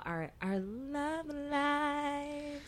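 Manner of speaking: very slow, high and soft; soft, high, long-drawn reveal. Real, not acted. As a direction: stretch the words out long and high. Introducing an awkward love topic.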